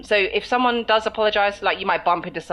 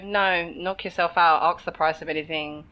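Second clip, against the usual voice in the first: usual speaking voice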